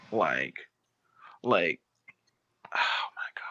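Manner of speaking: soft, voiceless sigh of dismay; exasperated whisper. Real, not acted. Bettor reliving his bad luck, exasperated whisper. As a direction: breathe it out in despair.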